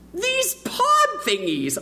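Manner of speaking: put-on high, slow little-boy voice; child's voice. Boy tempting his friend, high slow kid voice. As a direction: sound like a sly young boy.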